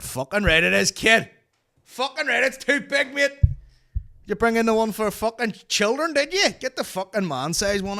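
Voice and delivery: high, loud hammed-up rant at the doctor; mock outraged yell. Retelling the doctor visit, yelling back in high mock outrage. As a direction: bellow it like a wounded big man.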